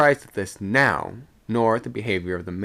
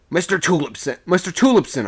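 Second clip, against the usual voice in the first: high, raised hail; urgent call for attention; 8 semitones higher, 6 dB louder than usual. A narrator's reading. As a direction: call up to an officer. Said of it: "I called out to the second".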